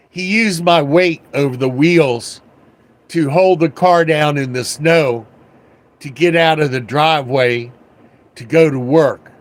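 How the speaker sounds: ordinary delivery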